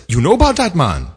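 imitated driver's high-pitched astonishment; high, excited mock-Jamaican questioning; startled, eager driver. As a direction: quote the driver pouncing on a shared interest. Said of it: "his head whips around. He says".

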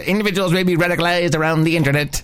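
put-on fast, punchy old-time announcer; old newsreel. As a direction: rattle it off like a newsreel. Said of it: "old-time, uh, movie newsreel announcers".

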